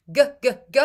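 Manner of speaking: G released sharp and loud; hard G drill. Shown as a demonstration. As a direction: bark out the hard G sounds. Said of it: "a subtly different bit of your tongue".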